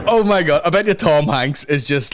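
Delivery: normal talking voice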